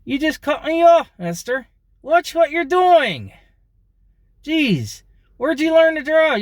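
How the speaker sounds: very high, loud, indignant ventriloquist yell; yell without moving lips. Shown as a demonstration. Driver yelling at one who cut in, high, lips unmoving. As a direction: berate a driver through motionless lips. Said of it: "I do without moving my lips".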